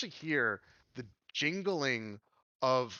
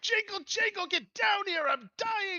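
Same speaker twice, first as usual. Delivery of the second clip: very high, loud little character; high-pitched theatrical yelling for help; exasperated pleading; 4 dB louder than usual. Acted. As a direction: holler upstairs in a squeaky, urgent voice. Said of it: "He says".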